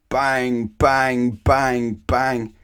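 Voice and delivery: loud banging bang bang; loud knocking. A narrator's reading. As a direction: pound each bang out. Postman knocking like bombs.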